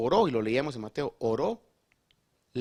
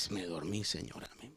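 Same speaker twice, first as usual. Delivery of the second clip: drowsy whispered amen; sleepy mumble; 8 semitones lower, 11 dB quieter than usual. Retold or imitated. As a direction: drop to a soft, half-whispered mumble. Dozing woman waking mid-prayer.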